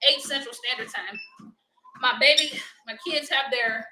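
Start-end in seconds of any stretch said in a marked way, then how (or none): none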